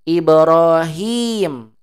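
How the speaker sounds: Ibrahim with the hi held long and slow. Shown as a demonstration. Teacher modelling Ibrahim for students, long drawn hi. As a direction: stretch the hi of Ibrahim out fully. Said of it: "Hi-nya wajib dipanjangkan".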